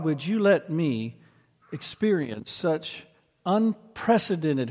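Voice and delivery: slow, searching pulpit delivery, starting high; emphatic preaching. Real, not acted. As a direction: press the question home slowly, phrase by phrase. Preaches a searching question to congregation.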